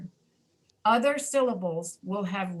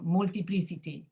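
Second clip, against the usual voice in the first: "multiplicity" Portuguese-style, every I a full "ee"; Portuguese-accented "multiplicity", mool-tee-plee-see-tee; Portuguese pronunciation. Shown as a demonstration. Teacher imitating Portuguese speakers for the class, Portuguese-accented "multiplicity". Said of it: "if you speak Portuguese, you're likely to pronounce it".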